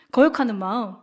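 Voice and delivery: usual speaking voice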